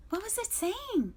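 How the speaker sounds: very high little groundhog. A narrator's reading. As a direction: squeak it like a worried groundhog. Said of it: "said Dig Down the groundhog".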